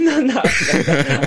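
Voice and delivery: laughing high through the no's; real laughter, stretched out and high; giggling protest. Real, not acted. Laughs off the creepy remark.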